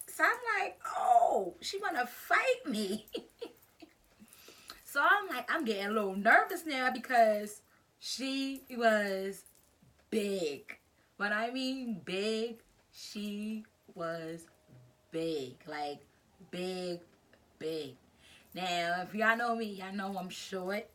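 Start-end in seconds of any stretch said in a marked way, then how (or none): 1.0-3.1 high, loud disbelief; drawn-out mock-shocked reaction; retold shock. Reacting to fight challenge. 10.1-17.9 "big" set apart by pauses, weighty; slow it down and land each "big" alone; emphatic BIG. Real, not acted. Stressing opponent's huge size.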